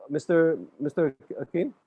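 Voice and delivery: ordinary delivery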